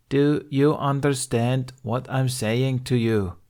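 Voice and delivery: slow and deliberate, spelled out word by word; slow, over-clear question to a stranger; slowly. Acted. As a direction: talk down to a foreigner, slowly. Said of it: "she said slowly".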